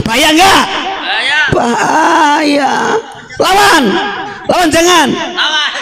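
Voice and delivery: high call-and-response shouting; short, high rally cries; rousing the crowd. Real, not acted. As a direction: fire up the crowd to shout back. Rousing crowd to answer back.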